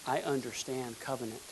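muttered conviction; soft aside. Real, not acted. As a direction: say it quietly to yourself. Said twice, first softly.

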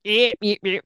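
imitating a working class Chicago accent, clipped eep sounds; mock the Chicago barflies with a rough Chicago accent. Retelling the bar regulars, a light mock Chicago accent. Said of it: "the very working class Chicago accent".

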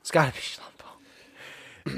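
quiet breathy whisper. Real, not acted. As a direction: whisper the name back. Echoing the joke name as a whisper.

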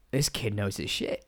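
gasp, then hushed low aside; impressed old man. Retold or imitated. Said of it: "made the old man go".

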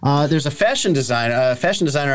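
normal talking voice

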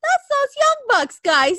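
high, slow eager mom voice; lightly voiced curious mother. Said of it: "she goes".

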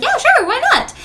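high, loud, carefree agreement; cheerful pushover saying yes to everyone; breezy yes. Retold or imitated. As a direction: chirp it without a second thought. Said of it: "I would just be like".

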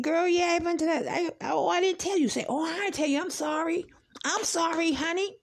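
high-pitched imitation of a friend backpedalling; imitated apologetic girlfriend. Girlfriend admitting she forgot to tell, apologetic. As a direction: play the friend owning up, voice pitched well up.

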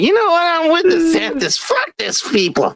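a fed-up mascot quitting in falsetto; high mock Mickey voice storming off; Mickey actor quitting. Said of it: "That guy in the suit is like".

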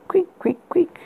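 high thin creak sounds; high squeaky creak. Acted. Creaking bed sound imitated.

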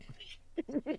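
breathy laugh. Real, not acted. Laughing at own joke.

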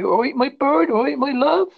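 put-on a touch of Devon accent. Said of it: "people used to come and say".

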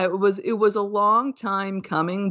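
ordinary talk

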